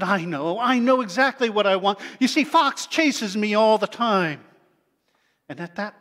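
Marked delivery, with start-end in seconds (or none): 0.0-4.4 louder and quicker rabbit voice; eager rabbit. Acted. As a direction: blurt it out like an eager little rabbit. Rabbit eagerly tells its wish.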